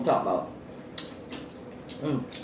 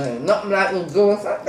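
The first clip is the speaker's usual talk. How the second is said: ordinary talk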